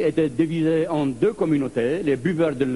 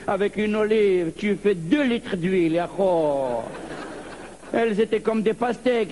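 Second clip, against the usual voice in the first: boasting pied-noir; 2 semitones higher than usual. Acted. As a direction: brag about Algiers with a wild lilt. Pied-noir storyteller voiced boasting.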